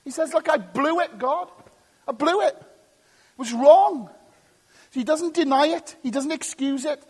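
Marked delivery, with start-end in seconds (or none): 0.3-4.1 high-pitched, urgent confession; frank admission. Acted. Preacher voices David admitting to God he blew it, frank and high. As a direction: own up openly, pitched high. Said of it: "Lays his cards on the table".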